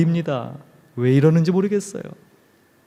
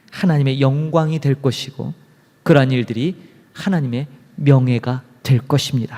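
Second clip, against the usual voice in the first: ordinary delivery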